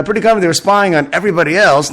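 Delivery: ordinary talk, nothing marked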